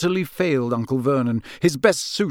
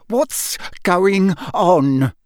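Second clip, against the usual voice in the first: very slow growl, higher and rising in force; angry growl; 5 semitones higher, 4 dB louder, much slower than usual. A narrator's reading. Uncle demands answers from nephew, growling. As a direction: drag out each word furiously. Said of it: "growled Uncle Vernon".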